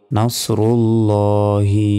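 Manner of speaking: নাসরুল্লাহি slowly, with rounded nos and heavy llo; heavy sad. Shown as a demonstration. Teacher modelling the phrase, slow নাসরুল্লাহি with heavy sad and lam. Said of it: "নাস বলতে হবে".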